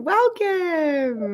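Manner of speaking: high, drawn-out welcome; excited welcome. Real, not acted. Host greets guests appearing on screen, excited welcome.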